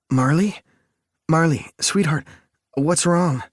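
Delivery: high, alarmed calling her name; frightened, rising panic; panicked. Acted. Partner collapsing in labor.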